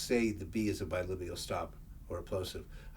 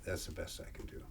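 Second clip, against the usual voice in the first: whispered aside; 3 semitones lower, 10 dB quieter than usual. Real, not acted. To himself after the recitation, a whispered aside. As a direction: whisper it under your breath. Repeats closing line to himself.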